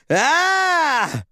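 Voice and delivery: high startled yelp; startled cry. A narrator's reading. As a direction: jump at the sight. Sudden UFO appears.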